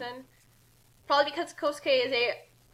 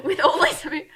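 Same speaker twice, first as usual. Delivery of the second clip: breaking into a laugh mid-read; 3 semitones higher, 6 dB louder than usual. Real, not acted. Narrator losing it over a line. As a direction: crack up while reading.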